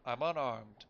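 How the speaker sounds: loud, firm call. Acted. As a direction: call out to show no threat. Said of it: "I called back".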